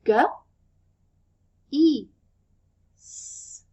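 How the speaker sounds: G, E, S said one at a time, last one whispered; separate sounds. Shown as a demonstration. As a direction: give each sound on its own to blend. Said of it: "I'm gonna blend these sounds".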